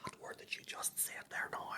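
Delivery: hushed whisper as if the word is shocking; scandalised whisper. Pretend. Teasing a friend about the shocking word, whispered.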